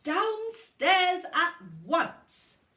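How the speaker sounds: loud, high, slow bellow. Acted. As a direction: roar the order at the kids. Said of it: "bellowed Missus Large".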